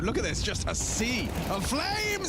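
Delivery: high, excited play-by-play; esports caster. Acted. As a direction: call the breakthrough with excitement. Calling the round win.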